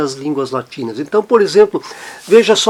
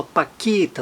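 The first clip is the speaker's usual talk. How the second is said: "paquete" softer, as pa-KET; stress on last syllable; 7 dB quieter than usual. Shown as a demonstration. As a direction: put the weight on ket in "paquete". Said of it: "a sílaba tônica no final".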